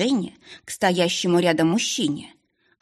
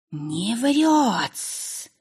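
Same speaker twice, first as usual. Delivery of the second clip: higher, hissing familiar voice; hissing snake; 5 semitones higher than usual. Acted. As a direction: hiss it thoughtfully like a snake. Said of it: "задумчиво прошипела у меня в голове Буся".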